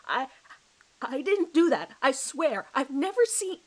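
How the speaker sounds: high, loud panicked protest; desperate denial. Acted. Accused man begging the detective to believe him, loud and panicked. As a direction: plead innocence in rising panic. Suspect accused of murder, denies.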